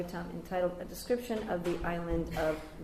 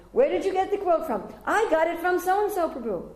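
brisk questioning; 10 semitones higher, 7 dB louder than usual. Retold or imitated. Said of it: "I went to so-and-so".

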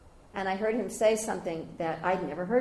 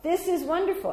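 delighted; 11 semitones higher, 5 dB louder than usual. Retold or imitated. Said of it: "and I said".

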